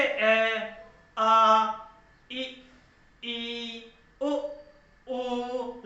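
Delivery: each vowel sound held long and continuous; drawn-out vowels. Shown as a demonstration. Teacher demonstrates vowels held long. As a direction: sing out ए आ ई ओ without a break. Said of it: "कैसे?".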